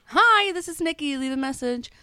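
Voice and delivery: high, bright-toned recorded greeting with a phone trill; voicemail greeting. Retold or imitated. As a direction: chirp it out like an answering machine. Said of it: "he calls back again".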